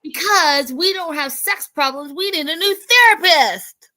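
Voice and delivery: loud, high indignant outburst; indignant yell. Retold or imitated. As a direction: yell it in outrage. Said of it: "she's like".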